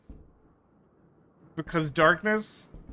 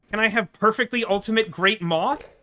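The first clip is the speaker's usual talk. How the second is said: ordinary talk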